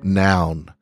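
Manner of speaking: usual speaking voice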